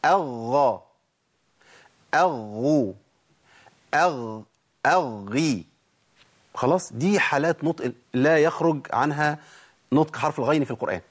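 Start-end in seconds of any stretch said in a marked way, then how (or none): 0.1-5.6 "أغا، أغو، أغي" with a hamza, then a held double ghayn; doubled ghayn drill. Shown as a demonstration. Teacher drilling doubled letters for students, doubled ghayn. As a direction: hold the doubled ghayn before each vowel. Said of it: "نجيب مشدد بقى ونجيب قبليه همزة".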